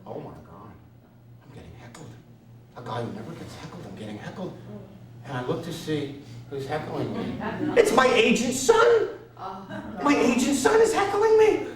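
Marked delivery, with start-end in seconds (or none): 0.1-4.6 hushed, shocked inner voice; panicked whisper. Retold or imitated. 7.8-11.7 high, loud disbelief; can't believe who it is; shocked outrage. Retold or imitated. Shocked realization.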